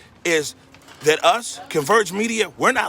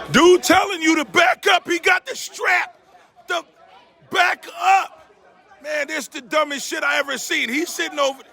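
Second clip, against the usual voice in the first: shouting; 8 semitones higher than usual. Real, not acted. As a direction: shout a warning at the crowd. Yelling again at armed man.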